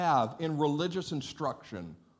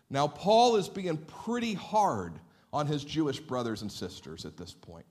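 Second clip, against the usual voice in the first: usual speaking voice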